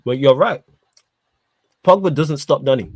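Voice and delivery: ordinary delivery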